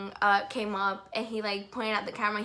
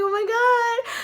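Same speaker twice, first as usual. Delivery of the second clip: much higher, louder, almost crying; starstruck squeal; 13 semitones higher, 9 dB louder, slower than usual. Retold or imitated. Fan reacts to idol talking to her, tearful squeal. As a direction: squeal it like you can't believe it.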